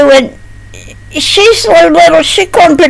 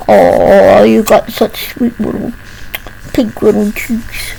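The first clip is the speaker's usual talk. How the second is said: put-on much lower and softer, cooing to a baby; tender cooing; 6 semitones lower, 5 dB quieter than usual. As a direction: coo softly at the little elephant. Cooing to the toy elephant.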